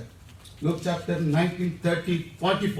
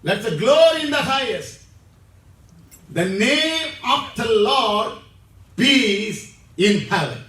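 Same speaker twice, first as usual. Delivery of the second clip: loud proclamation; 6 dB louder than usual. Acted. Preacher booming the angels' song to the congregation, loud and ringing. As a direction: ring out the praise full voice.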